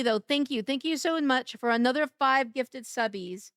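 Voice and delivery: ordinary delivery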